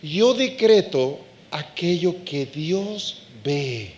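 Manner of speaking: slow, low and deliberate; grave slow proclamation; slow solemn decree. Shown as a demonstration. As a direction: lay each word down slowly. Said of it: "Voy a decretar".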